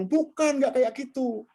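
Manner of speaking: lightly acted boss rejecting the work, pitched high; dismissive boss. Boss turns down a subordinate's finished task, high-pitched mock objection. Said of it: "diminta atasan".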